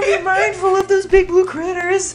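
very high, strained character voice; put-on game character voice. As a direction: push it up into a piercing silly squeal, drawing out the end. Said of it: "every time he does that voice".

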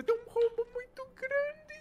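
alarmed call. Real, not acted. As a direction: cry out in alarm to your teammate. Alarm at big hole in boat.

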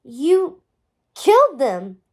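halting, punched-out accusation; pause, then land it hard; forceful accusation. A narrator's reading. Guinevere accusing Merlin of killing her parents, forceful. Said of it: "she added with more force".

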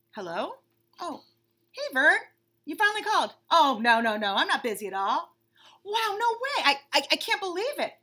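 high, excited chatter, tripping over words; gossiping bunny on phone. Acted. Bunny chatting eagerly with Vern on the phone, high. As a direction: gush to a friend.

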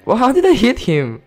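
high, loud outburst of amazement; loud disbelief. Real, not acted. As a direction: shout it in total surprise. Astonished at a hit in game.

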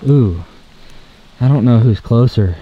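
ordinary delivery

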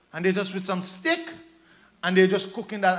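normal talking voice

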